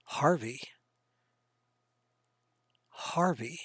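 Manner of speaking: hushed, voiceless name-calling; whisper. Real, not acted. Game master calling on Harvey about the helm, whisper. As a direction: whisper the name, leaving space after it.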